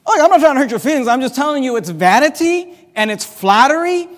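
fast, loud preaching rebuke; preaching at full force. Acted. Preacher scolding his congregation, loud and fast. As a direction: drive the point home from the pulpit.

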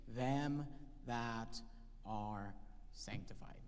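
whispered scripture ending; hushed. Acted. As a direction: drop to a whisper on the last words. Reading scripture, dropping to hush.

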